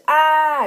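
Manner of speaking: high loud "Ah!" scream; mock scream. Villain screaming as the fire hits, high and loud. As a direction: let out a cartoon scream as fire bursts. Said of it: "And then he screams".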